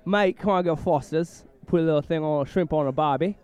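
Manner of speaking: hammed-up Aussie cliches in a wobbly accent; failed Aussie accent. Co-host's botched Aussie accent sounds British. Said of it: "I am a Brit all of a sudden when I tried to do an Aussie".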